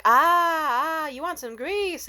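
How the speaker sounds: loud, high mocking vendor voice; mock a dim vendor having a realization; imitated idiot. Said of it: "And then this idiot, he's like".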